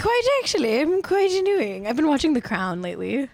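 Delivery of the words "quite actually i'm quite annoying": much higher, prim mock-British echo; hammed-up posh repeat of quite actually. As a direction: play it like a snooty aristocrat. Playful posh echo with co-host.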